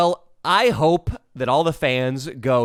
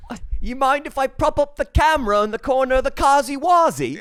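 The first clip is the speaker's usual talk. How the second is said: high-pitched put-on character voice; comically high, put-on request; comic character asking; 12 semitones higher, 4 dB louder than usual. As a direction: ask it high in a hammed-up voice. Acted character asking to film.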